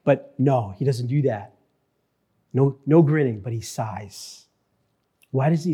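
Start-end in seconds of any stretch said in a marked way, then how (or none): 3.4-4.4 soft sighing words. Acted. Preacher describing Jesus' sigh.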